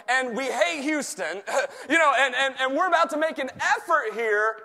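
high, loud rallying sermon; full-force preaching. Real, not acted. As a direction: fire them up like a team before a play. Preaches football analogy at full force.

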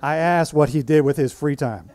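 loud, high, sassy comeback; sassy Nancy. Retold or imitated. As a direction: deliver it as a cheeky jab. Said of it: "you might not diffuse such concerns so skillfully".

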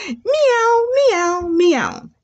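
three high imitated meows; high-pitched kitty cries; cat meows. Shown as a demonstration. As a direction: meow three times like a cat. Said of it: "And they say what?".

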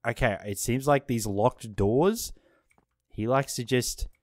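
ordinary talk, nothing marked

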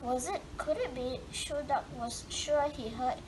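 plain reading voice

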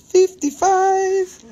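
high, slow, squeaky Fifty-five; silly high voice. Pretend. Seller repeating the price in a high silly squeak.

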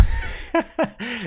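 cracking up at a joke; high burst of laughter. Real, not acted. Laughing at a gag.

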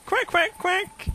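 high squeaky fake quacks; duck call. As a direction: quack as if you were a duck. Imitating a duck to lure ducks.

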